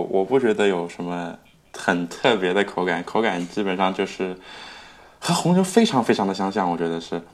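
normal talking voice

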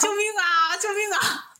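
half-laughing imitation of a wounded man yelling for help; mock cry for help. Stabbed man crawling out, calling for help: hammed-up mock yell. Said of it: "然后边爬还边喊".

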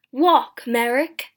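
loud, angry shopkeeper's order; forceful, irate bellow; angry command. A narrator's reading. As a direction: order him about angrily. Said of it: "said the shopkeeper angrily".

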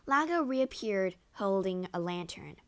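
plain reading voice

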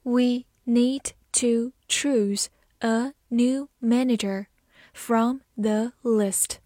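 word by word, with clear gaps; very slow model sentence for learners; slow reading. Shown as a demonstration. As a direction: take it slowly, separating each word. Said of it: "慢慢来读".

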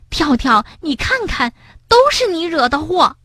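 high-pitched indignant blame; loud scolding. A narrator's reading. Bengbeng blaming Tiaotiao, scolding. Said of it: "蹦蹦大声责备着".

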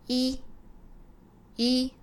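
crisp 一 as a pure Spanish i; Spanish vowel i. Shown as a demonstration. Teacher demonstrating the Spanish vowel i, clearly. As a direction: model 一 as a clean i. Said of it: "第三个是".

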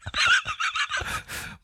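high-pitched helpless laugh. Real, not acted. Visitor amused by the nervous streamer, high laughter. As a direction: break into a squeal of laughter. Teasing the flustered host.

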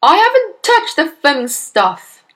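loud, high, slow denial; angry shout. A narrator's reading. Husband accused of playing with glue, shouting. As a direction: shout each word in protest. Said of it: "Mister Wormwood shouted".

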